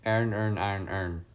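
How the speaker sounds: "Iron, earn, iron, earn" run into near-identical "ern" sounds; own accented pronunciation. Shown as a demonstration. Streamer showing how they would pronounce it, accented tongue-twister. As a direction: squash each word of "Iron, earn" toward "ern". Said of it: "that's exactly how I'd pronounce it".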